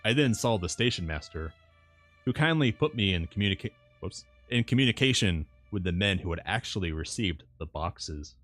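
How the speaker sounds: usual speaking voice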